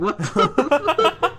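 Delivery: high, slow, laughed-out reaction; retold shock through laughter; laughing disbelief. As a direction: act stunned while cracking up. Teller laughs acting own shock.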